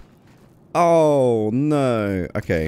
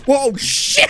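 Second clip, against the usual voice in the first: high, panicked shriek; real jump scare yell; startled scream. Real, not acted. Dog attacks in game.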